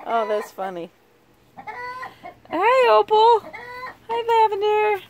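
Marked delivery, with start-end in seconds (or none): 1.6-5.0 high, sweet hello to the hens; cooing greeting. Real, not acted. Owner greeting her hens in a high cooing voice. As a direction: coo their names up high.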